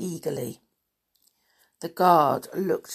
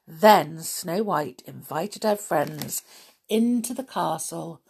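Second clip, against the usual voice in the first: plain reading voice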